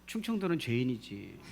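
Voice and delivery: low, soft, drawn-out mock remark; joking slow talk. Preacher teasing his congregation about Chungcheong, joking slow talk. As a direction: let it trail out lazily, teasing. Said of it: "왜 이렇게 느려".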